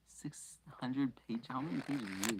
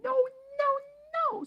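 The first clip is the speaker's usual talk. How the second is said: squeaking elf; 10 dB louder, faster than usual. Acted. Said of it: "squeaked Dobby".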